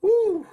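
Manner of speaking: high loud whoop after the hit; pained whoop. Real, not acted. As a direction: shake off the pain with a whoop. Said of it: "It hit my funny bone".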